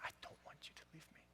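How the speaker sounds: fast, soft whispered confession; wife confessing her fear in a quick whisper; fearful whisper. Retold or imitated. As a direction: rush it out in a whisper.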